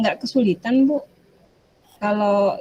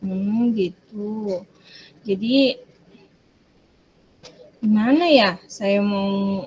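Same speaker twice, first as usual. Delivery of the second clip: ordinary talk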